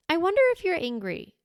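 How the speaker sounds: high-pitched wondering; tentative guess. Acted. Parent asking an upset child, tentative guess. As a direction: float it upward as a careful guess.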